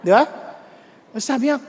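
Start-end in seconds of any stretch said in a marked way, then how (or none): none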